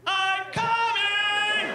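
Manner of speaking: high, long-held singsong call; mock shark warning. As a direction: announce yourself in a drawn-out high holler. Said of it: "they don't come out of the water like".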